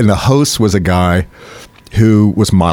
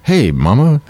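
smooth pickup line; 6 semitones higher, slower than usual. Retold or imitated. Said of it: "and he goes".

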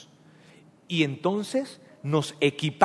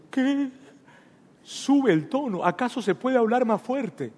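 incredulous, higher-pitched voice; mock surprise; 7 semitones higher than usual. Listener reacting to Juan raising his voice, higher and incredulous. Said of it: "Juan sube el tono".

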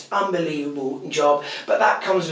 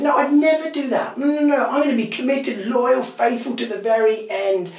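Peter insisting, pitched high and loud; Peter's protest; 8 semitones higher, 4 dB louder than usual. Acted. Peter to Jesus, swearing he'll never deny him, bold and high. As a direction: protest your loyalty with bold confidence. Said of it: "Peter said".

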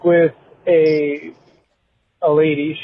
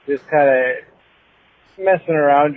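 ordinary talk, nothing marked

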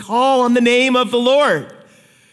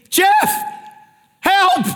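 panicked cry for help; 11 semitones higher than usual. Pretend. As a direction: shout his name, then beg. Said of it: "and you say".

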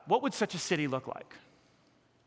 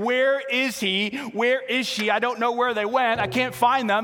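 mock searching God; 8 semitones higher, 8 dB louder than usual. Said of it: "God's not, like, wondering".

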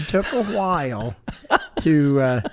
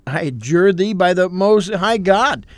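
louder, commanding rebuke; stern rebuke; 3 semitones higher, 6 dB louder than usual. Retold or imitated. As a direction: speak with firm authority. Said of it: "you rebuke the devil".

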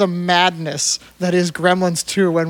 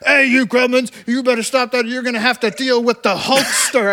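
Hulk Hogan impression; 3 semitones higher than usual. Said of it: "He's like".

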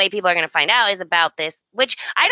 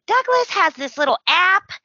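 ordinary talk

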